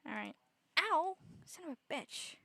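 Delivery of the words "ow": high, loud real ow; sharp yelp of pain. Real, not acted. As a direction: yelp as if just hurt. Sudden pain.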